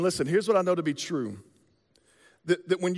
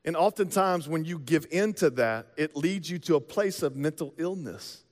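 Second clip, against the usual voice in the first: normal talking voice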